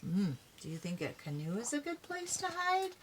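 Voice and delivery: plain reading voice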